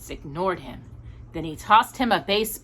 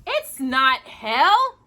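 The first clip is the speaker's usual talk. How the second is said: shouted; 5 semitones higher, much slower than usual. A narrator's reading. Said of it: "shouted Danny".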